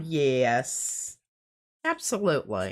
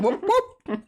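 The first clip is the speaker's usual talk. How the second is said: mock cheer; 14 semitones higher, 3 dB louder than usual. Cheering a chat message.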